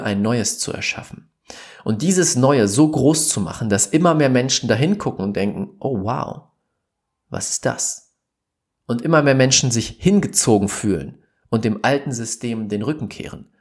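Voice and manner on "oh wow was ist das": soft, hushed amazement; awed wonder. Pretend. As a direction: breathe it out like seeing something wondrous. Imagined people amazed by new.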